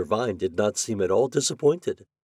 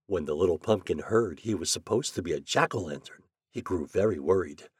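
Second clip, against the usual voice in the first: plain reading voice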